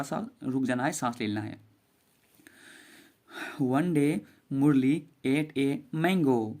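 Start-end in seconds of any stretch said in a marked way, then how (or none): 3.6-6.5 very slow, word by word; slow model reading. Shown as a demonstration. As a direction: read it slowly for the class to repeat. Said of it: "आप मेरे साथ पढ़ेंगे". (बहुत धीरे-धीरे, शब्द-शब्द पढ़ना)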